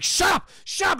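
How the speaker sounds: high, loud frantic yell; acted panicked shouting; freaking out. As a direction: scream back at the voices. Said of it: "He's just freaking out".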